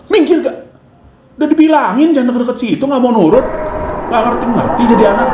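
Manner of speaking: put-on out-of-control parent yelling at child; angry parent. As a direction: snap at the kid who won't move. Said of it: "Nah, beda".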